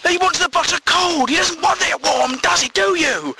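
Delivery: high, insistent nagging; mock argument in a high-pitched voice; bickering girlfriend. Girlfriend drags a friend into butter argument, high and insistent. Voices arguing couple, woman appealing.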